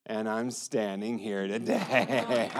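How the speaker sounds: laughing through emotion, slow; a moved laugh as the words come out; emotional laugh. Real, not acted. Survivor telling the crowd he lived, laughing through tears.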